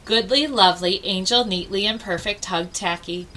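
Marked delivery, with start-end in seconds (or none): none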